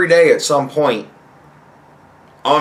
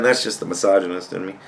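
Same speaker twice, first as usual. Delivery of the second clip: usual speaking voice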